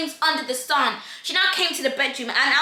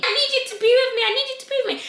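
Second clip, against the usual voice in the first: high, frantic mimicked begging; desperate pleading; 8 semitones higher, much faster than usual. Crying girl with the knife begging Jacob, desperate. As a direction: imitate her distraught plea, pitched way up.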